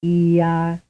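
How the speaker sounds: two separate vowel beats; two-vowel demo. Shown as a demonstration. Teacher sounding out a quiz item. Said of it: "How many vowels do you hear".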